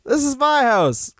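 high-pitched indignant protest, hammed up; mock indignant claim. Comedian playing someone who took a house, mock indignant. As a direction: insist on it in a high put-on voice. Acted boast of a house-stealer.

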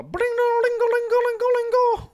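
loud, high-pitched ringing alarm noise; high, rapid bling-a-ling imitation; imitated phone alarm. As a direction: trill it out like a phone alarm going off. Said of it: "all you heard was just a".

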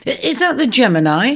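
cried out. Acted. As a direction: call up into the trees. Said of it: "he cried".

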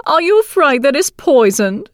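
high, teasing crone voice; mock her fear like a witch; sly old crone voice. A narrator's reading. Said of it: "asked the old woman".

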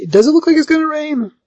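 high, incredulous squeak of a question; mock surprised Basil. Basil feigning surprise at Kate's rain worry, high and mock. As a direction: pitch it way up, playing dumb. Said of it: "he's like".

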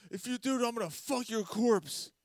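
high-pitched, insistent mock warning; hammed-up high ultimatum; imitated desperate threat. Acting out friend's threat.

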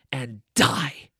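DIE spat out like a filthy word; Maleficent. Acted. Villainess cursing a child, heavy venomous stress on DIE. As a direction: curse with all the emphasis thrown on DIE. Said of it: "like she's saying this filthy word".

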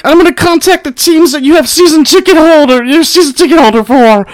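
high whiny mock voice. Mimicking haters threatening to call his teams, high and whiny. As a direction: squeak out the threat like a petty critic. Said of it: "some people are like".

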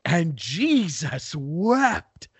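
very slow, drawn-out dramatic line; milk it like the Dean. Retold or imitated. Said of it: "he's running and is like".

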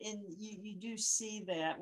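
usual speaking voice

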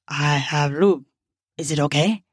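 low character voice, asking; voiced male character. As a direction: drop your pitch for the man's line. Narrator voices Jack's line.

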